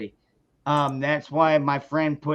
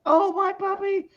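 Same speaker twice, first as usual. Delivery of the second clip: high mock wail of the owner; distraught dog owner. Owner running to her shot dog, high mock wail. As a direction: voice the woman crying for her puppy. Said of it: "the woman comes running up".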